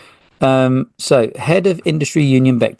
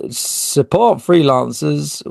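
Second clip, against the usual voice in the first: put-on slow, plummy posh English accent; posh English, drawn out; 2 semitones higher, slower than usual. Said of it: "I think that was a posh voice".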